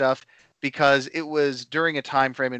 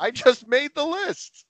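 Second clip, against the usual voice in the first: high-pitched, hammed-up exclamation; mock dismay; 10 semitones higher than usual. Podcast host plays along with Jericho's list gag, mock dismay. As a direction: squeal it as a playful catchphrase.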